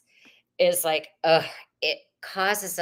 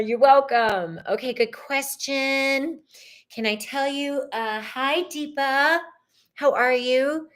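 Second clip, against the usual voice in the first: higher, louder host welcome; upbeat chat greeting; 5 semitones higher, 4 dB louder than usual. Real, not acted. Host greeting Deepa in the chat, upbeat and higher. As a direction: greet the viewers brightly.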